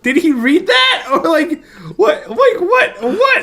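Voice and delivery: high, laughing what-the-heck; incredulous squeaky confusion; baffled disbelief. Retold or imitated. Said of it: "And I was like".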